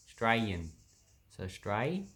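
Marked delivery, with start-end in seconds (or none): 0.1-0.7 "Australian" said as "Strayan", dropping the AU and the L. Shown as a demonstration. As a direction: clip "Australian" down to two syllables, "Strayan". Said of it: "we get rid of the A U".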